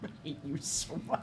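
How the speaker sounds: low-volume whispered grumble; whispered mock hate. Real, not acted. Player reacting to a friend's pun, whispered grumble. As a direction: whisper it under your breath.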